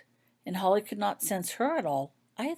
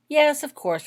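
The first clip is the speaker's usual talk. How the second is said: quick, higher, louder impatient reply; 9 semitones higher, 8 dB louder than usual. A narrator's reading. Holly, impatient with the healer's doubt, snapping back higher. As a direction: answer like the question is obvious. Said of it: "replied Holly impatiently".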